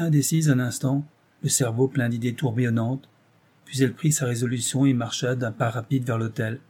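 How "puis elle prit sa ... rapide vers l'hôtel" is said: ordinary delivery, nothing marked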